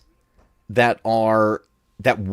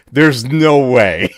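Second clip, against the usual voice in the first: disbelieving; 7 dB louder, slower than usual. Retold or imitated. Retold disbelief at footsteps.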